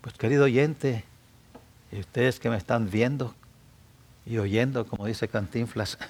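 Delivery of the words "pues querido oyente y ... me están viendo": normal talking voice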